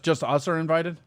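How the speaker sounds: ordinary talk, nothing marked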